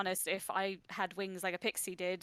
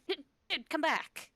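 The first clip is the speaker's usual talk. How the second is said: high, loud, urgent call; 8 semitones higher, 6 dB louder than usual. Acted. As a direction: call a runaway pet back. Calling after runaway pet salamander.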